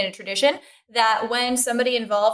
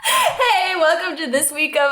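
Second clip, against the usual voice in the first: laughing; 9 semitones higher, much slower than usual. Real, not acted. Laughing through the greeting.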